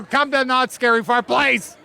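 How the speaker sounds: loud plea. Real, not acted. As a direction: beg the crowd to come. Pleading invitation to the audience.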